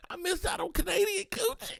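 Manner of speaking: high, hammed-up whimper; fake-sob over a missed chance; mock crying. Mourning missed Canadian women, hammed-up high whimper.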